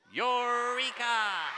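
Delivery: long, high, loud stretched-out cry; really excited eureka. Shown as a demonstration. Author shows how to say it really excited, slow and loud. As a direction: thrill at a big discovery. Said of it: "really excited because you discovered something".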